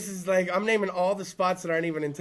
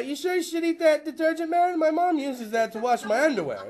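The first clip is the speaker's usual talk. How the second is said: a lightly voiced dopey buddy, higher; 6 semitones higher than usual. Comic to crowd, voicing dopey buddy, lightly higher. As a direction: play the worried dumb friend. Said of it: "my buddy going".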